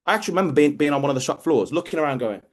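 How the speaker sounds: normal talking voice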